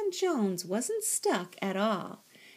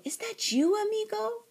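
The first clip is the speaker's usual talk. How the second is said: high, loud surprised question; exclaiming; 4 dB louder than usual. A narrator's reading. Kitten recognises his friend, high exclaiming. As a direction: ask it soaring upward in amazement. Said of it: "exclaimed Skipito".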